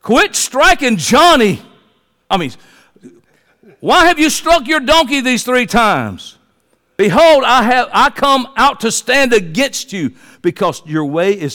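high, loud, slow rebuke; stern angel. Acted. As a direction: rebuke him as the angel, word by word. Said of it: "this is like what the angel said".

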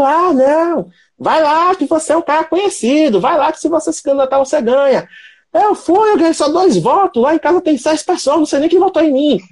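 high-pitched, indignant Mineiro man; comic mock outrage in a thick Mineiro accent; angry Mineiro accent. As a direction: imitate a pissed-off guy from Minas Gerais, voice pushed way up. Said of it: "aquele sotaquezinho carregadinho assim, o cara puto".

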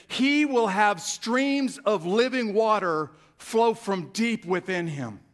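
high, loud, stretched-out proclamation; crying out. Acted. Jesus standing up at the festival to the crowd, crying out. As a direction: call it out slowly over a whole crowd. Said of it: "and he cried out".

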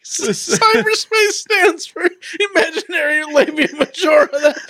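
breaking up mid-sentence; reading through giggles; laughing while reading. Real, not acted. Reads joke while cracking up.

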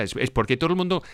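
ordinary talk, nothing marked

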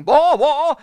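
high, loud laughing "Boer War!"; excited shout. Retold or imitated. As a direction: shout it with glee. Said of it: "meant it to be said that way".

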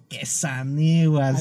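qué saniguas said very slowly; slow slang demo. Shown as a demonstration. Showing listeners how to tease a show-off, slow. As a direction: drag out qué saniguas as an example. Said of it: "asaniguas es para aquellas personas".

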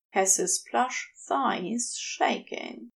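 ordinary delivery

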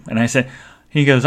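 ordinary talk

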